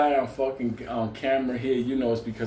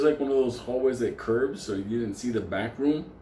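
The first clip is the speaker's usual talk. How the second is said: usual speaking voice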